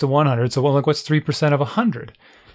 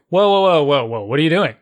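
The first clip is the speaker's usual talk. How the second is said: retold alarmed stop; 3 dB louder than usual. Father stops son again.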